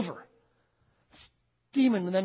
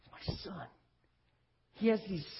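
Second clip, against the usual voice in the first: whispered plea; 8 dB quieter than usual. Acted. Father begging Jesus for his son.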